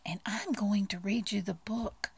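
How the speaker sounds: ordinary delivery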